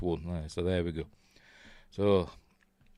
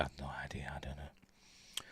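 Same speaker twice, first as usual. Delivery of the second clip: whispering; 7 semitones lower, 19 dB quieter than usual. Real, not acted. Muttered aside to himself.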